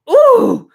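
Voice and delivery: high, loud "ooh"; delighted whoop. Real, not acted. Fan savoring the bathtub extravagance, loud delighted whoop.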